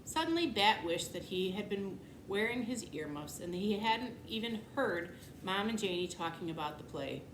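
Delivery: plain reading voice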